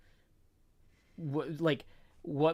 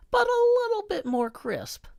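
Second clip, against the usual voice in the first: mock-lecture with exaggerated pitch swings, climbing very high at the end; sarcastic voice; 6 dB louder than usual. Shown as a demonstration. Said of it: "using kind of a sarcastic voice".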